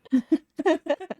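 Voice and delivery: a short, high, nervous giggle; nervous laugh. Real, not acted. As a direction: laugh off the fear. Laughs after "I'm terrified.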